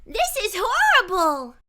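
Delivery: lightly voiced dismayed girl; dawning realization. A narrator's reading. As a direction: let it dawn on her. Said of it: "Harper realized".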